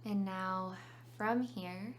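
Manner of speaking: calm meditation guide with long pauses; unhurried phrases, leaving room to breathe; guided meditation. Real, not acted.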